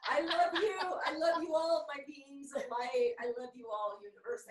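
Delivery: loud, high gushing love; effusive loud declaration. Real, not acted. Gushing to listeners.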